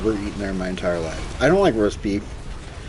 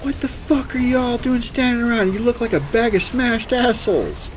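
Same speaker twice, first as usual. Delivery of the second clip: high barked drill sergeant voice; high mock drill sergeant yell; 13 semitones higher than usual. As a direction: bark it like an angry drill sergeant. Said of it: "this drill sergeant comes and goes".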